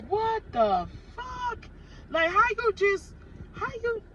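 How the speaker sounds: drawn out and high-pitched incredulity; retold disbelief. Woman recounting her reaction to the lottery win, incredulous. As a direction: stretch it out high, stunned.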